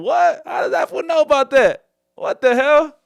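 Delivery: high, loud startled yelling; hammed-up shock at being caught out; mock panicked shout. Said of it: "He's supposed to say".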